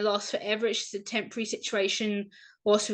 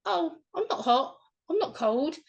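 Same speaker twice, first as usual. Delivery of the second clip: much higher, carefree denial; breezy denial; 7 semitones higher than usual. Retold or imitated. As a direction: toss it off lightly. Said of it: "I'm like".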